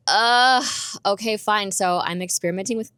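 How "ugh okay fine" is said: loud, higher groan, then giving in; grudging surrender. Retold or imitated. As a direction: act out an exasperated "fine". Retold reluctant giving in to God.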